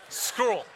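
"Scroll" for squirrel, squashed into one syllable; mispronounced word. Shown as a demonstration. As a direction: say "Scroll" as an accented attempt at squirrel. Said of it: "There's certain words I just can't say".

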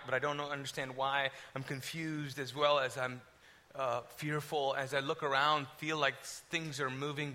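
low, softened voice of dread; hushed suspense storytelling. A narrator's reading. Speaker recounting unseen attack in bedroom, hushed suspense. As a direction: draw them into the night terror, low and quiet.

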